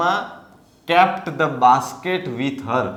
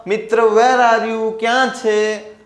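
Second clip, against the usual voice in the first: crying out loudly; 9 semitones higher, 6 dB louder than usual. Acted. Reshma searching for her missing Mitra, crying out loudly. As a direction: call out loud and high, stretching the words. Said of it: "બૂમ પાડી".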